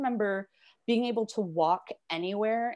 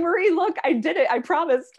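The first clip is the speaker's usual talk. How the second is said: higher and louder, bursting with pride; excited pretend report; 9 semitones higher, 7 dB louder than usual. Imagining telling her old teacher she read aloud, proud excited announcement. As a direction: show your old teacher you finally did it.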